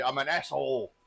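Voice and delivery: normal talking voice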